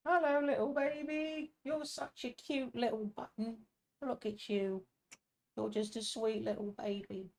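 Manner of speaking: very high, soft cooing. Real, not acted. Cooing to the baby.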